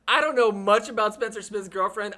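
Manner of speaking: normal talking voice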